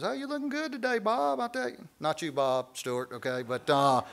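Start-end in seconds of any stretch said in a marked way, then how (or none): 0.0-1.9 high, sweet flirting coworker voice; flirty woman. Retold or imitated. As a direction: coo it like a woman flirting at work. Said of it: "this woman says".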